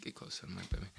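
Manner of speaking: soft unvoiced aside; whisper. Real, not acted. Host whispering to girlfriend about the mic, whisper.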